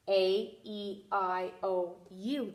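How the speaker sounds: "A, E, I, O, U" said as letter names; teacher listing the long sounds A, E, I, O, U; long vowel sounds. Shown as a demonstration. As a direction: recite each long vowel evenly and separately. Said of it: "When they say their name".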